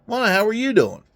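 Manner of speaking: put-on high, sweet old lady; a high voiced old lady, cheerfully fine. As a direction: chirp it like a cheerful grandma. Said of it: "She goes".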